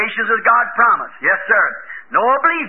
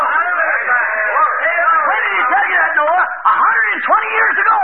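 excited exchange; 6 semitones higher, much slower than usual. Retold or imitated. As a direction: call it out with rising excitement. Scoffer and Noah trading lines.

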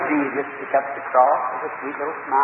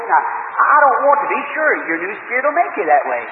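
pleading churchgoer's prayer; 5 semitones higher, 6 dB louder than usual. Pretend. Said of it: "If you just say to God".

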